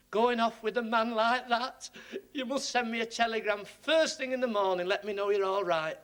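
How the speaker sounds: hint of an anxious old woman; old lady's worry. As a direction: fuss like a worried spinster sister. Old lady fretting over sister.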